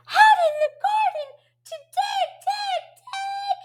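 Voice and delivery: very high, quick echo of the line; high questioning voice. Acted. Teacher questioning the pig's answer, high voice. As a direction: squeal it back way up high. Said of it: "Ask him the question".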